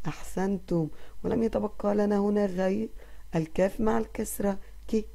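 normal talking voice

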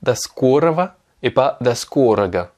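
da skorova and da skoraga said carefully, contrasting V and G; Russian model pronunciation. Shown as a demonstration. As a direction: set da skorova against da skoraga. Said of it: "Pourquoi est-ce qu'on dit".

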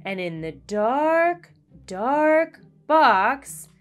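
slow, hushed spooky storytelling; teacher telling a ghost story to the class, slow and hushed. A narrator's reading. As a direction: draw out every dark to build dread. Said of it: "whispered in her best ghost story voice".

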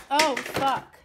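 sudden loud startled swear; startled curse. Real, not acted. Opening the overflowing box, a loud startled curse. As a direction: blurt it out in real shock.

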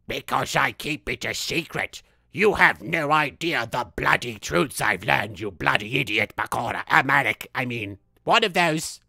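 low-pitched villain impression; Bakura. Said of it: "here's my impression of Bakura".

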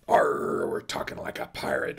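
a hint of a growly pirate accent, hammed-up; mock pirate accent, tossed off for a laugh; bad pirate voice. Podcast host hams up Talk Like a Pirate Day, mock pirate accent. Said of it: "enough of my bad pirate impression".

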